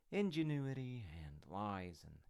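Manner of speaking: usual speaking voice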